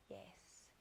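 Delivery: ordinary delivery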